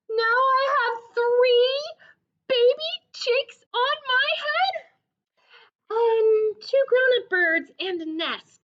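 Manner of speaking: high, loud, complaining elephant; exasperated Gerald. Acted. Gerald tallies the birds on his head, high and upset. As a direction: Gerald listing his woes.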